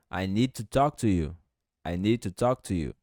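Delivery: ordinary talk, nothing marked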